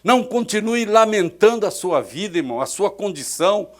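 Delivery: loud rousing sermon climax; loud fervent preaching to the church; preaching at full force. Acted. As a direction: proclaim it loudly, slowing on the promises.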